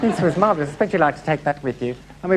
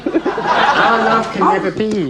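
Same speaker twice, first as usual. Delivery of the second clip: high, slow overwrought line reading; hammy melodrama; 6 semitones higher, 4 dB louder, slower than usual. Shown as a demonstration. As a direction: overact it like a tragic lover. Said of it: "feel free to overact".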